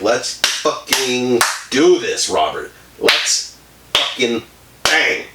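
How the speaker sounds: very slow, word by word; emphatic proposition. Retold or imitated. Edna propositioning Robert in the pigeon house, slow and emphatic. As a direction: draw out each word with deliberate pauses, playing bold seduction. Bold proposition acted out, drawn out.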